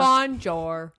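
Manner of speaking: drawn-out American-accented French; joking, heavily American French greeting. Shown as a demonstration. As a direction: mangle the French with broad American vowels. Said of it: "Sounds so American".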